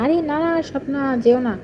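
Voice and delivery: high-pitched pleading, falling back down; coaxing protest. Real, not acted. Streamer begs upset Swapna not to leave, coaxing. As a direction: coax them not to go, pitch jumping up.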